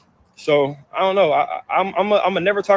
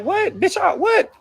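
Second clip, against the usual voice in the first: much higher, pitch jumping up in shock; outraged disbelief; 12 semitones higher, much slower than usual. Real, not acted. Son recalling his mother's threat, shocked outrage. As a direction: blurt it out as if he can't believe it.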